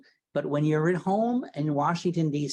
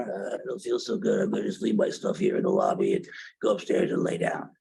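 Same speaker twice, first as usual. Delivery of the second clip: much lower, fast, groggy sick voice; sick husband imitation; 7 semitones lower than usual. Husband home from Europe tells wife he feels ill, much lower sick voice. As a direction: imitate a man coming down with pneumonia. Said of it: "that's exactly how he sounded".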